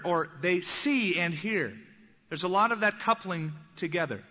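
ordinary talk

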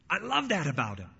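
ordinary talk, nothing marked

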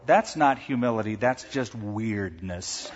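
ordinary talk, nothing marked

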